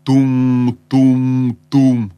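loud, level "tum, tum, tum" of a ram; dull booming thuds. A narrator's reading. Narrator imitates a distant battering ram hitting the gates, dull booming thuds. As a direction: boom out each heavy thud evenly. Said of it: "тупо и глухо булкнуло".

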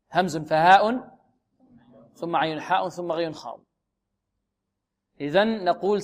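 ordinary delivery

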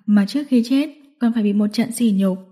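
usual speaking voice

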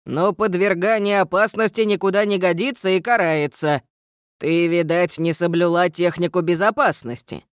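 gruff stern gnome teacher. Acted. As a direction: lecture like a strict gnome.

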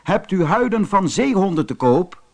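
storyteller voicing a stranger's inquiry; rider asking. A narrator's reading. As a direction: call the question up from horseback. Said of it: "vroeg de ruiter".

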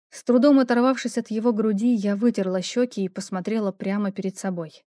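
plain reading voice

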